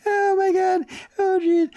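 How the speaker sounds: high voice breaking with real laughter; cracking himself up. Real, not acted. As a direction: gasp it out, helpless at your own joke. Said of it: "Sometimes I crack myself up".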